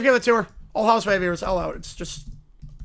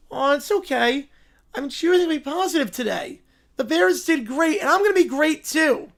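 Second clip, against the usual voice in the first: gently upbeat cartoon bear; lightly voiced, sunny little bear; cheerful bear; 5 semitones higher, 4 dB louder than usual. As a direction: answer the whiner with determined positivity. Plays positive bear.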